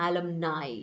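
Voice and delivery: "alumni" ending in a NAI sound; plural ending pronunciation. Shown as a demonstration. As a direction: say "alumni" as a-lum-NAI, rhyming with eye. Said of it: "not alumni അല്ല". ("alumni" അലംനായ് എന്ന ഉച്ചാരണം)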